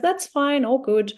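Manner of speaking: forced breezy fine. Retold or imitated. To her withdrawn friend, a high bright forced fine. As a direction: pretend it's no big deal, brightly.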